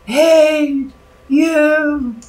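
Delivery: high, loud, drawn-out mock wail; cursing. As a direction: curse each word up at the sky. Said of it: "she curses up at Zeus".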